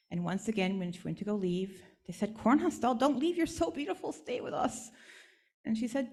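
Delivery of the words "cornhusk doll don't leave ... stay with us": put-on higher, childlike begging voices; little kids begging their friend not to go; pleading children. Village children begging the doll to stay, high and pleading.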